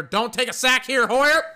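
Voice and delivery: high, loud frantic shout at the TV; a fan's shout climbing to a shriek on the name; panicked yell. Real, not acted. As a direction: scream it like he's about to get sacked. Yelling at quarterback on TV.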